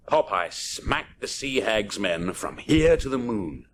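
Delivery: ordinary delivery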